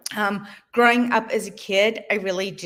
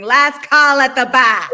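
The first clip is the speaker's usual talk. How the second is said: louder call through a laugh; playful shout; 4 semitones higher, 9 dB louder than usual. Retold or imitated. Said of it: "your ovaries constantly screaming".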